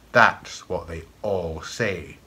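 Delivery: put-on slow, dry old master's reply; knowing reply. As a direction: drag it out like a sly old master. Master answers boy's hopeful boast.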